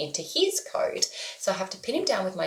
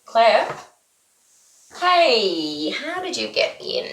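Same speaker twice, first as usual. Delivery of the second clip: louder call with a rising question; 3 semitones higher, 6 dB louder, slower than usual. Real, not acted. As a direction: mum calling her daughter who just came in. Calling the child who walked in.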